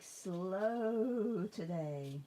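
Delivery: dragging the words out slowly; drawn-out slow. Real, not acted. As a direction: act it out sluggishly. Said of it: "I'm, like".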